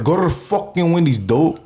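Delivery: performed New York skit line; imitated New York accent. Shown as a demonstration. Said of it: "Go ahead and bust that out".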